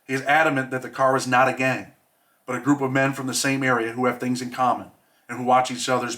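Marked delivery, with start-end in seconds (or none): none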